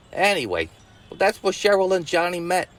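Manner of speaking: usual speaking voice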